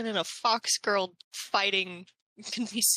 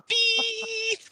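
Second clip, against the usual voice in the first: long beep; 11 semitones higher than usual. Retold or imitated. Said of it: "one long beep at the end".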